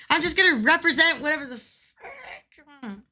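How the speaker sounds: loud, laughing and defiant; laughing defiance. Real, not acted. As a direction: blurt it out through laughter. Defiant outburst while playing.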